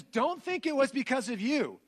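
booming divine proclamation, slow and high; God's voice. Acted. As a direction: thunder it out as God declaring his choice. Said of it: "God says".

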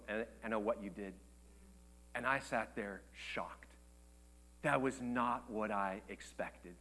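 soft, intimate preacher's hush; hushed sermon storytelling. Acted. Sermon drops to intimate retelling.

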